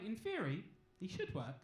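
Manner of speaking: dry doctor. Retold or imitated. Doctor voiced dryly at birth.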